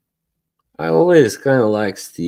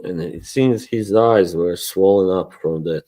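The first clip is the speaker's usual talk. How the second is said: normal talking voice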